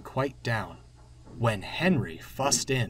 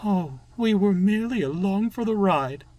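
higher, louder resigned coach voice; sighing; 9 semitones higher, 6 dB louder than usual. A narrator's reading. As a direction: sigh it out in a put-on voice. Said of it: "Annie sighed".

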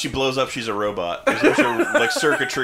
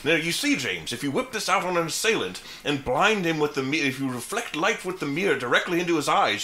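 higher, fussy gadget-explainer voice; 5 semitones higher than usual. Acted. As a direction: explain it like an eager egghead inventor. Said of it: "like, Q".

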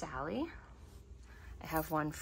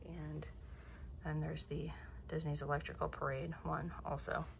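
whisper; 9 dB quieter than usual. Real, not acted. Quietly pointing out an ornament.

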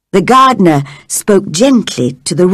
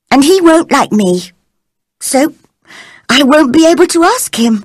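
put-on higher, wondering young girl; 6 semitones higher than usual. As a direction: muse aloud as a young girl. Mary's voice, thinking aloud.

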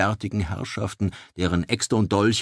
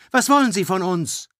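higher, anxious cry of Was wollen sie; 11 semitones higher, 8 dB louder than usual. Acted. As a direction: call out the worried question. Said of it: "rief Vera Löwenhaupt". (aufgeregter Ausruf)

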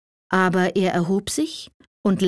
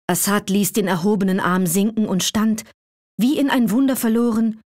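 plain reading voice

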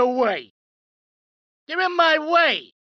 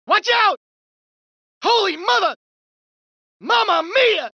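alarmed shouts; 5 semitones higher than usual. Acted. Thug ducking under fire.

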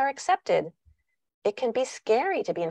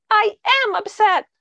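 much higher and louder, insistent; upset daughter; 13 semitones higher, 7 dB louder, slower than usual. Acted. Daughter insisting to mom she is upset, high and loud. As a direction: stamp it out like a cross little girl.

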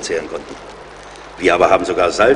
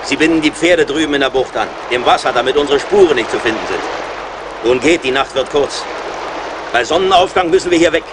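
raised voice, pitched higher, over the rushing falls; calling over roaring water; 7 semitones higher than usual. Acted. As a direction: call it out above the noise of the falls.

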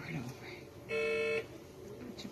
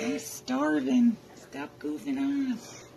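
put-on high, slow baby voice; voicing the baby; 8 semitones higher, 8 dB louder, much slower than usual. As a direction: speak for the newborn, drawn out and loud.

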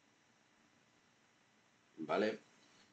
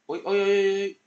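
higher, louder rising uy uy; tense near-miss excitement; excited; 10 semitones higher, 9 dB louder than usual. Real, not acted.

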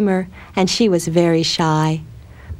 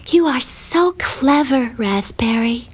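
put-on shy admiring girl; 5 semitones higher, slower than usual. A narrator's reading.

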